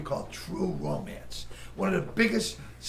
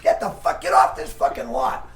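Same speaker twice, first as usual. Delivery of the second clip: retold shout; 4 semitones lower, 7 dB louder than usual. Director throwing the comic out of the audition, furious shout. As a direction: scream him out of the room. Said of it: "And he goes".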